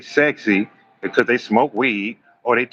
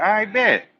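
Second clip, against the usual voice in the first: sarcastic I bet; 10 semitones higher than usual. Retold or imitated. His retold sarcastic reply.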